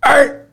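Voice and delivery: sharp high growl at the dog; short loud mock growl. Shown as a demonstration. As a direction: bark out a quick growl. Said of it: "our method of growl and replace".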